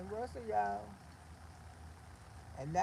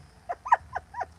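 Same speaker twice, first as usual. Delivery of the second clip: shrill burst of laughter, louder; high laugh; 6 dB louder than usual. Real, not acted. Auntie laughing at boxing threat. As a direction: cackle at your own joke.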